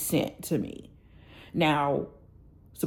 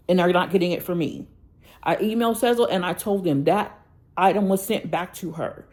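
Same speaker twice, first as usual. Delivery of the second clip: usual speaking voice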